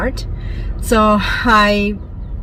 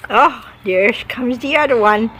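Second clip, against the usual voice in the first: ordinary talk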